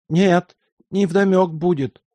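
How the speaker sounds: put-on old servant. A narrator's reading. Old servant Luka answers guest.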